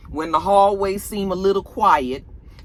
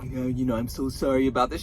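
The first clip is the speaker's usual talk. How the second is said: apologetic Tariq; 7 semitones lower, 3 dB quieter than usual. Retold or imitated. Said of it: "Tariq is all like".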